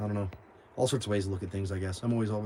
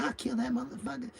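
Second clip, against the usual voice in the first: high, softer mock threat; lipping off; 11 semitones higher, 6 dB quieter than usual. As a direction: imitate her griping in a raised pitch. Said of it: "she's lipping everybody off".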